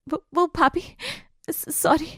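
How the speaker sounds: meek, halting excuse; trembling, timid. Acted. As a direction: stammer out a timid apology. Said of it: "कांप रही आरोही".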